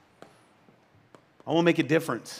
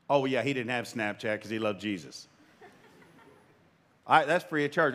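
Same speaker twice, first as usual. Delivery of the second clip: ordinary talk, nothing marked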